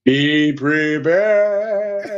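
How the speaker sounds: very slow, drawn out menace; villain voice. Pretend. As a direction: stretch every syllable like Scar. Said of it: "Lion King line, very slow".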